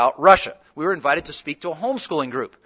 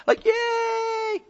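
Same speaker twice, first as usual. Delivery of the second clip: mock kids cheering; 5 dB quieter than usual. Kids cheering at Skype surprise.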